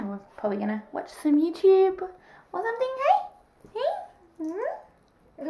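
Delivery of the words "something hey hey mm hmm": much higher, softer coo; squeaky pet talk. Real, not acted. Asking her dog about watching YouTube, soft high coo. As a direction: ask the dog sweetly up high.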